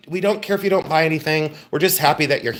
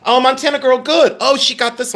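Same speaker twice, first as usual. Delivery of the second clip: excited cheer; 7 semitones higher, 3 dB louder than usual. Real, not acted. Excited at winning bidder.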